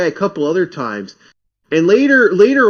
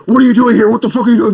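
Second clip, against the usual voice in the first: loud, high mock rant trailing into gibberish; retold screaming; 7 semitones higher, 7 dB louder, faster than usual. Landlady's son rants at apartment hunter, imitated screaming. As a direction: imitate an angry man yelling, then let it dissolve. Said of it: "He starts screaming at me".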